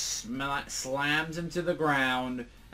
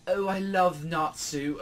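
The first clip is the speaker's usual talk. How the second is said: exaggerated British accent, drawn out and higher; 7 semitones higher, 5 dB louder, slower than usual. Fan girl declaring love for her idol, hammed-up British. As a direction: gush slowly in over-the-top British. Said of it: "expect her to be very British".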